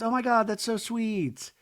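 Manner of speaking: high, gushing girlfriend imitation; mock-sweet high girlfriend voice; touched girlfriend. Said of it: "And she goes".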